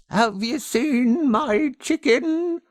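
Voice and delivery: put-on high, slow, strange old woman's voice; a lightly voiced odd old neighbour asking; old woman asking softly; quiet old woman. A narrator's reading. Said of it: "she asked in a quiet voice".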